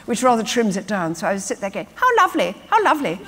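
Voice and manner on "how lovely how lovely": high, affected, simpering how lovely; ghastly posh voice. Retold or imitated. Actress mocking the posh girlfriend voice she was made to use. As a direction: coo it like a prim girlfriend on a sitcom. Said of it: "speak in this ghastly voice".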